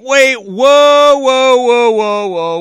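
high, loud volley of whoas; loud interruption. Real, not acted. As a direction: cut in loudly to halt everything. Breaks into clip in disbelief.

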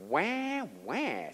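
drawn-out crybaby wah; mock whining. Preacher mocking Gideon's excuse to the congregation, mock whining. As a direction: mimic a whiny crybaby. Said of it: "What a whining objection".

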